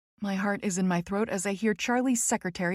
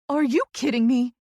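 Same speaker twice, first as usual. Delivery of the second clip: higher, louder disbelief; crying out; 4 semitones higher, 5 dB louder than usual. A narrator's reading. Friend astonished by Tess's question, crying out. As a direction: exclaim it, punching the question. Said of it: "she cries".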